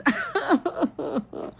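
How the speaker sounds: high, broken mock wail; imitated burst of crying. Recalling somebody crying in the dream, high imitated wail. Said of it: "that's just how my cousin started crying".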